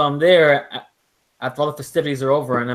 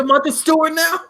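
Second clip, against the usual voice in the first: jeered way up high; mocking high jeer; 14 semitones higher, 4 dB louder than usual. Buddies jeering at a guy carving pumpkins. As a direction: sneer it in a mocking falsetto. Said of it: "Look at you".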